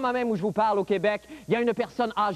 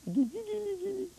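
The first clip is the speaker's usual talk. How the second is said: put-on baby talk; 10 semitones higher, 7 dB quieter than usual. Tickling kitten.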